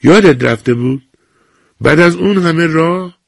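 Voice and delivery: can't believe she forgot; frowning disbelief. A narrator's reading. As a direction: nurse frowning at the forgetful old woman. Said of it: "ابرو در هم کشید".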